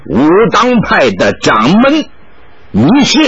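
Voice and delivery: put-on halting, slowed foreign-accented Mandarin; a touch of a foreign monk's awkward Chinese; stiff foreign Chinese. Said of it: "用生硬的汉语说道".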